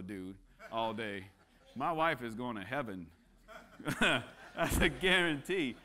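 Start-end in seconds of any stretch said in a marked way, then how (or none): 3.8-5.8 laughing through a joke, loud. Real, not acted. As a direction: laugh out the punchline. Joking about his son.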